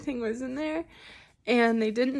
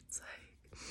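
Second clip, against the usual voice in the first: breathy; 24 dB quieter than usual. Real, not acted. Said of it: "Take some deep breaths".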